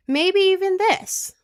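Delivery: high, loud and slow, thrilled; squealing delight over the photo; excited. Real, not acted. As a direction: gush over the picture. Excited about hoped haircut.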